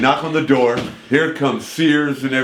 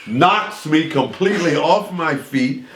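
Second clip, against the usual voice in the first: ordinary delivery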